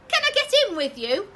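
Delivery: put-on high, loud toddler; little one asks mum, high and loud; high child voice. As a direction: ask like an eager toddler.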